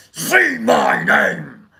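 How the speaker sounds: slow shouted say my name. Retold or imitated. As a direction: growl it out slowly like the meth boss.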